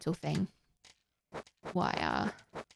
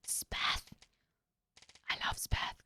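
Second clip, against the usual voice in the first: soft breathy whisper of a fan; hushed adoring whisper; 9 dB quieter than usual. Real, not acted. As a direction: whisper it like a secret crush. Whispering fan confession about Speth.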